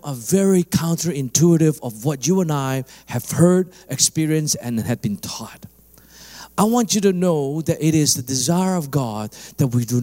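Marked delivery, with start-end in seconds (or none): 4.6-5.7 hushed, breathless close to the line; whisper. Acted. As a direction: drop to a whisper as if confiding. Preacher drops to whisper.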